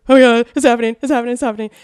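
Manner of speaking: excited fan. Retold or imitated. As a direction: blurt it out like a thrilled fan. Retells excitement as star approaches.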